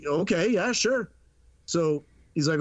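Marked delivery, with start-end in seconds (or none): 0.1-1.1 retold uneasy yes, rising high; hesitant agreement. Ticket seller who didn't wanna say no, hesitant agreement. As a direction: go along with it, pitch climbing.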